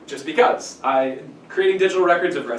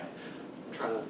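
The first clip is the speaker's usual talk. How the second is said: normal talking voice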